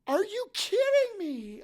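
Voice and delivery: shocked disbelief. Retold or imitated. Wife floored by the stranger's story, loud stunned disbelief. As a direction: let it burst out, floored. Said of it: "And my wife's like".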